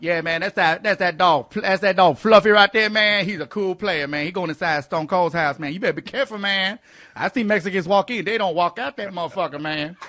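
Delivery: loud swaggering street character voice; street guy character. Acted. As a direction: play a brash guy from the neighborhood. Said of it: "I can cuss 'cause it's in character".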